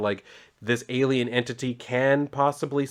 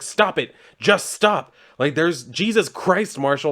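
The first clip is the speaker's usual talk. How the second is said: imitating a mother losing it, pitched high; retold frantic outburst; 6 semitones higher, 4 dB louder, slower than usual. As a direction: act out her exasperated, raised-voice plea to stop. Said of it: "decides to lose her shit".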